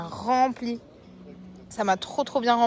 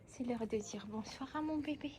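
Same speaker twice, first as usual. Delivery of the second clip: much softer, hushed and tender; soft tender announcement; 12 dB quieter than usual. Real, not acted. Going to the cat, soft and tender. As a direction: ease into it gently, for the cat.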